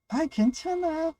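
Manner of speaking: high, slow, brushing it off; mock brave elder. Voicing an elder who hides pain.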